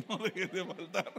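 repeats the slip while chuckling; laughing through it. Real, not acted. Preacher laughing at his own slip of the tongue. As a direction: giggle as you say it.

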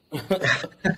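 short chuckle; real laugh. Real, not acted. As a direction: laugh it off briefly after a hard story. Laughs after crazy story.

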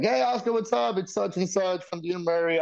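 high, cheery phone greeting; high-pitched retold phone hello; caller voice. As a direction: imitate an assistant ringing up, pitched high. Acting out assistant's phone call.

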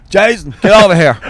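mock-cross father's shout; imitated stern dad. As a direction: call your son over sharply. Dad sternly summons son in story.

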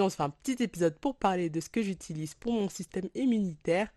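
ordinary delivery

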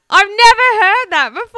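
loud, high, amazed; excited. Real, not acted. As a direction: blurt it out, thrilled. Laughing exclamation.

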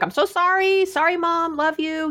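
high, sing-song mock apology; kids' apology. Teens apologizing to mom every time, high mock sorry. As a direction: imitate teenagers tossing off an apology.

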